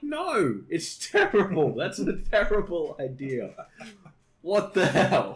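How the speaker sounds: high, emphatic mock outrage; hammed-up indignation; exasperated protest. Acted quarrel, rejecting the idea.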